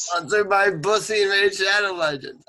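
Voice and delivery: high, loud mock announcer; mock sponsor read. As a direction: belt out a joke sponsor plug.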